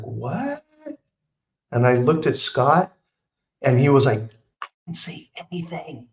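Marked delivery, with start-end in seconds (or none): 0.0-0.6 much higher, soft incredulous rise; a hushed, shocked what; stunned. Retold or imitated. Newly sober guest stunned by the vodka reading. 4.6-6.1 whispered denial; barely voiced, defensive. Buddy whispering that he never tipped off the psychic.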